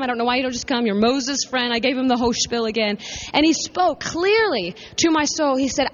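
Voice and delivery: usual speaking voice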